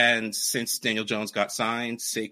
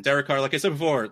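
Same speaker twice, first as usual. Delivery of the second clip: ordinary delivery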